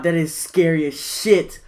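ordinary delivery

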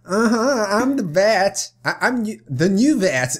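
put-on character voice. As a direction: struggle to hold a character voice. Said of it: "while I'm doing the voice".

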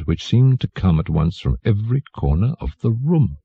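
plain reading voice

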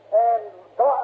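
normal talking voice

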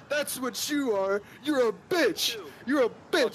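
high mocking taunt.

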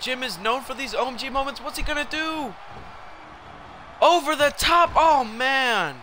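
high, excited announcer-like build; hyped play-by-play. Real, not acted. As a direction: build hype toward the big dive. Builds up to a big dive.

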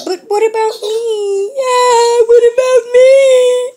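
put-on high whiny baby voice; voicing the baby. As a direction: speak for the baby, high and pleading. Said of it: "She's like".

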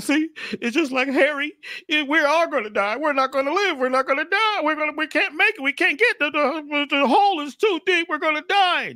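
very high squeaky frog voice, anxious; tiny frogs sure they're doomed; frightened frogs. Acted. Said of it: "the other frogs started saying".

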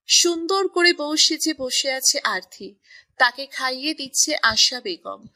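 ordinary delivery

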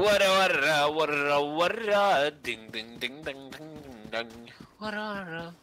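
silly high sing-song; e-girl voice. Pretend. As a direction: chant it like a playful e-girl. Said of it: "Why are you not sounding like an e-girl?".

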